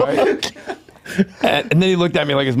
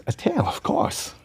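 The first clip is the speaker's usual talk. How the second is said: breathy; 8 semitones higher, 7 dB quieter, slower than usual. Retold or imitated. Walken answers.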